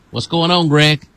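ordinary delivery

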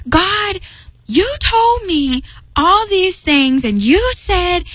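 quoting herself pleading with God, high and slow. Retold or imitated. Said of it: "And then I was like".

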